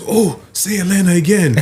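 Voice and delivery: high-pitched fascinated fan; delighted London lady. Retold or imitated. London lady begging Chicago visitors to repeat a word, delighted. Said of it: "this lady was like".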